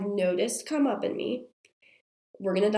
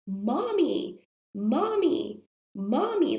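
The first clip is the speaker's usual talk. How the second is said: higher, repeated calls, mildly raised; imitated child yelling; 5 semitones higher, slower than usual. Middle child calling from across the hall, mock yelling. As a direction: lightly mimic a toddler calling out for Mommy. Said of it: "I hear her yelling".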